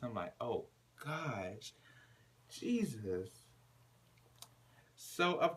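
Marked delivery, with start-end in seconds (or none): 0.4-3.3 quiet. Retold or imitated. Speaker's aside reaction.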